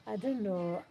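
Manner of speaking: ordinary delivery, nothing marked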